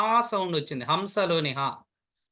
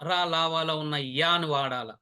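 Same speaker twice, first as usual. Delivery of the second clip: ordinary talk, nothing marked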